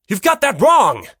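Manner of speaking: loud, high rebuttal; loud retort. Acted. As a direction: shout down your own thought. Countering himself in his head.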